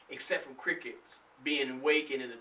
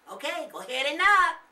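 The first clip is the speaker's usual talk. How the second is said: higher, louder daring call; 13 semitones higher, 10 dB louder than usual. A narrator's reading. Nathan daring Charlie at the door, loud and playful. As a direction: egg him on loudly. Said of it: "Nathan said out loud".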